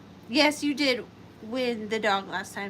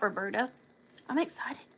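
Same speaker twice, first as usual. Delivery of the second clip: usual speaking voice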